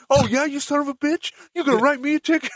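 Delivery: high-pitched imaginary comeback; indignant. Retold or imitated. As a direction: argue angrily with someone who isn't there. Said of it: "you have those shower conversations".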